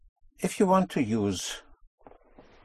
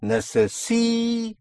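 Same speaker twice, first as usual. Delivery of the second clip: "Necesito" with SI high and very long; penultimate stress; 10 semitones higher than usual. Shown as a demonstration. Teacher showing the penultimate push, "Necesito" stretched high on SI. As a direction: jump up and hold SI, pause before TO in "Necesito". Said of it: "on the penultimate syllable".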